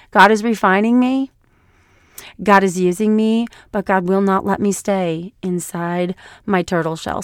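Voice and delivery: ordinary talk, nothing marked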